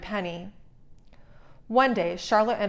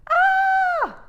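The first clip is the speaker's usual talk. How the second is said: huge high piercing shriek, much louder; shocked scream; 7 dB louder than usual. A narrator's reading. Tiana screaming at her frog reflection in mirror. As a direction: shriek at your own reflection.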